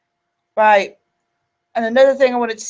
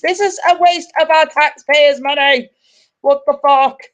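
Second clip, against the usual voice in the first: higher-pitched exasperated protest; indignant outburst; 5 semitones higher, 5 dB louder than usual. Real, not acted. Streamer breaking off from reading the article to protest, indignant outburst. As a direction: burst out in real indignation.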